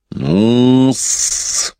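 high hissed aside; hissing. A narrator's reading. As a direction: hiss it out snake-like. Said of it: "прошипел он". (шипя)

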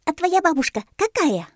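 high, loud little monkey voice; inquisitive monkey. A narrator's reading. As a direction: ask eagerly, pitched right up. Мартышка бойко спрашивает удава. (любопытная мартышка, высоко и звонко)

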